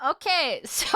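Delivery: ordinary delivery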